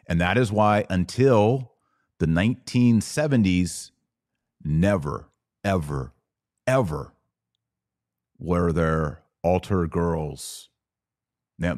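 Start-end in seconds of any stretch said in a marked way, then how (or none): none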